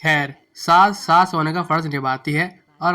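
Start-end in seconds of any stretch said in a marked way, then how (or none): none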